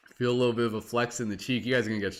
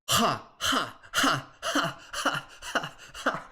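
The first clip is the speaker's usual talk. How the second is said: higher breathy ha ha laughs; forced laughter; 6 semitones higher, much slower than usual. Shown as a demonstration. Leading the second round of laughter practice, ha laughs. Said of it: "Exhale the sound ha".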